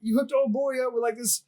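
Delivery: high-pitched mock complaint; imitating a freak-out. Airman blaming his sergeant for the introduction, flipping out. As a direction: accuse him in retold indignation. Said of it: "he just flips out".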